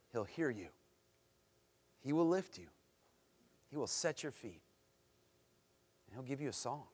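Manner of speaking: normal talking voice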